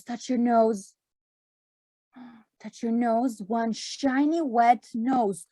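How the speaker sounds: usual speaking voice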